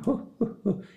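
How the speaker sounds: quick amused laugh, pitched high; short high laugh. Real, not acted. Laughing at the students' praise, a quick high laugh. Laugh after pupil quote.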